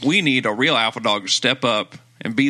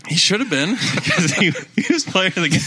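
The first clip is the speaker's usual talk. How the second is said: laughing; 6 semitones higher, 3 dB louder than usual. Real, not acted. Laughing while agreeing.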